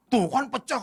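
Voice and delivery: loud, high goading; memanas-manasi. Retold or imitated. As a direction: imitate a boy stirring up his elder. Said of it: "manas-manasin lagi dia". (meniru anak memanas-manasi dengan suara tinggi)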